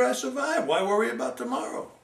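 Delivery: high, loud chirpy bird voice; a sparrow boasting to the crow; carefree sparrow. Acted. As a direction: toss it off lightly, up high.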